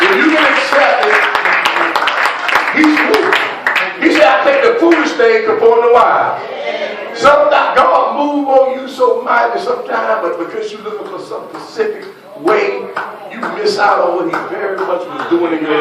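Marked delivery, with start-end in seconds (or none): 0.0-10.2 loud, high preaching at full force; full-force sermon climax; fiery preaching. Acted. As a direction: drive it home to the congregation.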